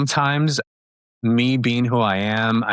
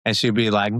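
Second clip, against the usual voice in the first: normal talking voice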